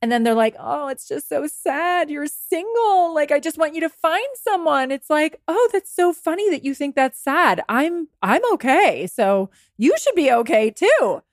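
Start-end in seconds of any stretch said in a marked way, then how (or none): 0.6-4.9 pitying. Acted. Acquaintance pitying someone for being single, syrupy pity. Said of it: "someone's pitying you". 5.5-11.2 higher, sweetly unbothered comeback; unbothered reply. Acted. Single person answering someone's pity, sweetly unbothered. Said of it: "Right? It's not like".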